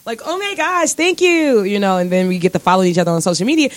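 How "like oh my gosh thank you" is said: high, gushy imitation of fans; gushing fan. Fans gushing over her cute looks, imitated high. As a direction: mimic the fans, sweet and high.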